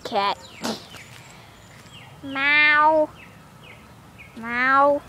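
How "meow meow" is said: drawn-out mock miaow; imitated cat meow. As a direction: mimic a cat, stretching each meow. Meowing at the cat.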